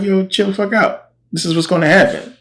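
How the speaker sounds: a high, laid-back dismissive prophet; casual brush-off. Retold or imitated. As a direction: toss it off like it's no big deal. Elisha brushing off angry king.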